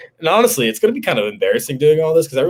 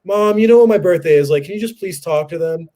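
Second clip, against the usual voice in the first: high retold plea, starting louder; exasperated pleading; 6 semitones higher, 3 dB louder than usual. Son begs mom to talk to cops, high mock pleading. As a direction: act out begging mom to back you up.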